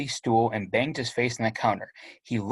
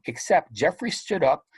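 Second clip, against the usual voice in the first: ordinary delivery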